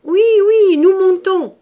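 put-on very high, loud, frightened old woman; mother answering her angry son, frightened; trembling hurried reply. A narrator's reading. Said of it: "répondit madame Faujas".

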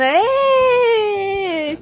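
long, high drawn-out "êêê"; high-pitched cheer held very long; gleeful kids' whoop. Retold or imitated. Retelling kids sliding on the mattress, imitated long whoop. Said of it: "ele escutava a gente fazendo".

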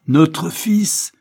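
high, loud indignant cry; shout. A narrator's reading. Judge rejecting his son, shouting high and loud. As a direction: hurl it back in outrage. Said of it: "cria le juge".

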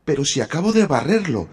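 protesting. A narrator's reading. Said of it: "protestó Cenicienta".

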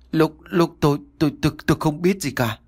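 stammer, barely getting the words out; stammering, lost for words. A narrator's reading. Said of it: "Hưng nói không nên lời".

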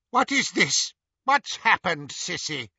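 high, demanding entrance; imperious woman. A narrator's reading. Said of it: "wound Harry's fear to an even higher pitch".

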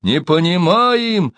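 high, slow distant reply; storyteller voicing a far-off shout; calling back. A narrator's reading. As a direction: call it back, stretching the words. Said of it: "опять отозвались из-за ворот".

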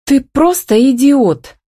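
high-pitched, drawn-out exasperation; indignant and incredulous, stretched out. A narrator's reading. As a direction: protest slowly, pitch soaring. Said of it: "возмутилась она".

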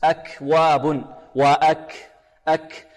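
أكواب slowly, with a puff of air after k; slow aspirated kaf in أكواب; breath on the kaf. Shown as a demonstration. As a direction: release the k of أك with audible breath. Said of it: "الكاف فيها همس".

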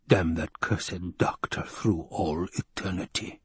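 low, slow muttered curse; slow low villain's curse; Baron's curse. Acted.

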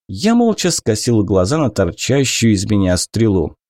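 ordinary talk, nothing marked

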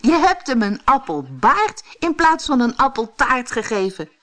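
high, animated teasing; merry storybook exclamation; amused, laughing remark. A narrator's reading. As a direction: tease with laughter in the voice. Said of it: "lachte Brammetje Braam".

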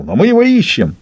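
high, eager show of optimism; cheerful bark. A narrator's reading. Уйбуй assures the baron they are searching, cheerfully barked. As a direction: put on pep for the boss. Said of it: "радостно гаркнул".

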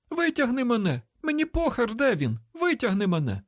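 very high, frantic demand; panicked screaming. A narrator's reading. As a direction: cry desperately to be pulled out. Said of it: "Не кричи".

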